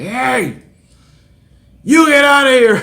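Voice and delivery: loud, high-pitched mock yell at a trespasser; acted angry yell. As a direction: holler it like someone ordering a trespasser off. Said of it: "He starts yelling at me".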